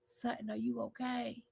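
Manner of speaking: an exaggerated whispery sympathy; mock soft concern. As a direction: lean in and murmur it. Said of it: "how you gonna lean over and say".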